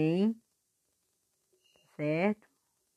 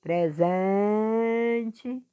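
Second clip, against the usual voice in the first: "Presente" with SEN held long, high and loud; teacher drawing out SEN in "Presente"; stretched stressed syllable; 4 semitones higher, 5 dB louder than usual. Shown as a demonstration. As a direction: pull hard on "sen" in "Presente". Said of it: "puxando mais a sílaba forte".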